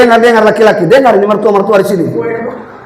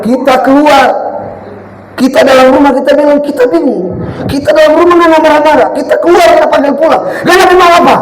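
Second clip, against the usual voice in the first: rising preaching; 7 semitones higher than usual. Acted. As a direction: build the fervour to a peak. Preaching builds to climax.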